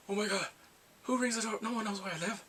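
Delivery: lightly panicked, startled by the doorbell; imitated freak-out. Man alarmed at his doorbell ringing, imitated mild panic. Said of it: "So he's freaking out".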